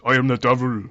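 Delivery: put-on Welsh accent. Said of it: "in his Welsh accent".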